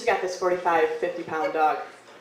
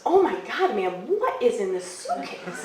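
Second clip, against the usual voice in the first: mock strained astonishment; 2 semitones higher than usual. Storyteller acts the helper lifting the heavy suitcase, strained and amazed. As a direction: act heaving a heavy load while asking.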